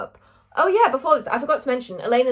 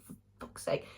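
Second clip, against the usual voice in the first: whisper; 14 dB quieter than usual. Retold or imitated. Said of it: "and I was like".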